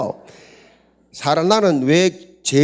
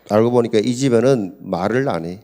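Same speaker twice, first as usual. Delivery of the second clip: ordinary talk, nothing marked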